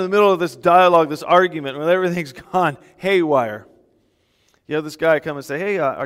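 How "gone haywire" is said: usual speaking voice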